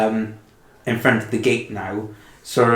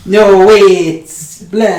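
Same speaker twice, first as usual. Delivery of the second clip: loud high outburst; 8 semitones higher, 8 dB louder, much slower than usual. Acted.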